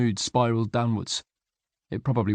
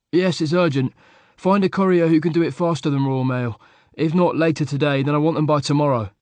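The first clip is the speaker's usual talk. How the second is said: louder, higher, clipped orders; impatient insistence; 6 semitones higher, 5 dB louder than usual. A narrator's reading. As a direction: brook no delay. Luke insists on urgency.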